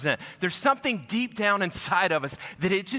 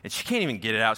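ordinary delivery

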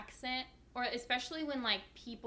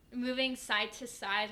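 ordinary delivery

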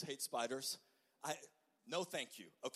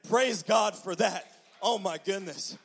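slow, much louder, higher praise; preacher's joyful exclamation; 4 semitones higher, 11 dB louder than usual. Real, not acted. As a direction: shout thanks to God. Relief after killing spider.

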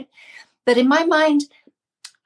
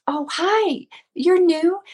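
higher-pitched friendly welcome; kind greeting; 8 semitones higher, slower than usual. Retold or imitated. Gym lady welcoming a newcomer, kind and high-pitched. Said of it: "she was just so kind".

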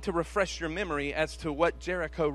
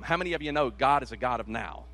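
ordinary delivery

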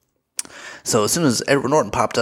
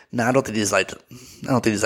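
ordinary talk

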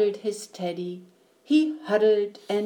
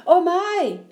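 higher, louder exclamation of dismay; sudden realization; 9 semitones higher, 5 dB louder than usual. Acted. Mom realizes she forgot the pie, dismayed. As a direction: exclaim as if just remembering.

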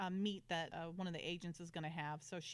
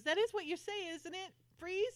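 high, loud playful tease; teasing Amanda; 10 semitones higher, 4 dB louder than usual. Retold or imitated. Amanda teasing Lee after the fake gun, high and playful. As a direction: needle him with a sweet mock question. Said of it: "she goes".

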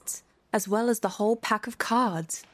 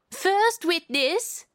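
much higher, slow herald's call; 10 semitones higher, much slower than usual. A narrator's reading. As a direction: summon the witness across the hall. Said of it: "called out".

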